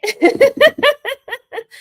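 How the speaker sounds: high mock cackle; Kamala cackle. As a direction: imitate her high laugh. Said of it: "she laughed".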